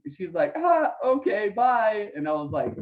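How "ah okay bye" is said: high, louder mock mom, breezy dismissal; hammed-up mom hanging up fast; mom brushing off. Son mimicking mom shrugging off Karl Malone, high mock voice.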